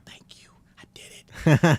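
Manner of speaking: slow private whisper. Retold or imitated. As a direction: whisper it to yourself, slowly. Quiet self-praise at premiere.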